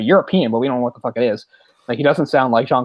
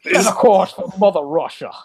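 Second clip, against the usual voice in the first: higher-pitched, hammed-up Scottish brogue; Sean Connery; 7 semitones higher than usual. Ordering the sub home to Russia, in mock Scottish brogue. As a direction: give the Russian captain's order in a Scottish accent. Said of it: "Sean Connery, making no attempt".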